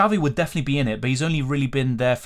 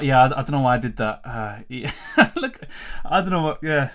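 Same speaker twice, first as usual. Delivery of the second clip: normal talking voice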